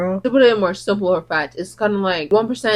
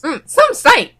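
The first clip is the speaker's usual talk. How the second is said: imitating friend; 9 semitones higher, 4 dB louder, slower than usual. Friend's comment acted out.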